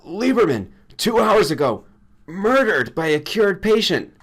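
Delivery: shouting bad news. A narrator's reading. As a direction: Granger shouting the news by phone. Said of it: "shouting more bad news".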